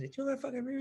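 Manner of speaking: high, soft mock-girl retort; imitated indignant girl. Girl answering Tom's argument, imitated indignation. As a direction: argue back in an imitated high voice. Girl arguing back at Tom, retold.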